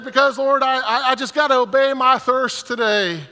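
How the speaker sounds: high, loud hammed-up praying; high, loud comic prayer; mock fervent prayer. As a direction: pray like he's trying to outdo her. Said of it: "And I said".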